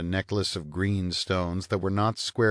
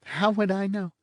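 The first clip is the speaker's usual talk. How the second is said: high, languid teasing woman; bored blonde; 12 semitones higher than usual. A narrator's reading. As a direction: toss it off, lazily mocking.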